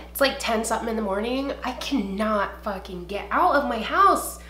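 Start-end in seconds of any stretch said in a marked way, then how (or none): none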